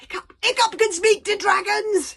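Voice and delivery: high, full-voiced shout; very loud shout. Fishlegs announces his friend's gift to everyone, very loud shout. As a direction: shout it out to the whole crowd. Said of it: "shouted Fishlegs very loudly indeed".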